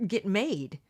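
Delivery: usual speaking voice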